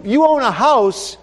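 ordinary delivery

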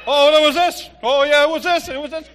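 high, loud, rising flustered answers; flustered doctor. Retold or imitated. Doctor answering the wife's questions, high and flustered. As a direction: stammer out answers under pressure. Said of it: "the doctor's answering her".

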